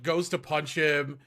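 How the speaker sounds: usual speaking voice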